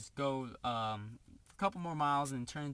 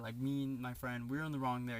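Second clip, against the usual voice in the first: ordinary talk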